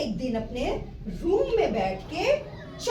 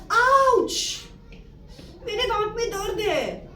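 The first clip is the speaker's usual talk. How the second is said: ouch of pain; 6 semitones higher, slower than usual. Acted. Teacher voices Bubbles suddenly feeling toothache, high pained yelp. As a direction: cry out like a kid whose tooth just hurt.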